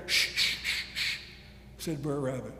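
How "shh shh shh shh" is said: repeated insistent shushes; string of quick "shh"; urgent shushing. A narrator's reading. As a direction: hush a noisy friend. Rabbit hushes raccoon.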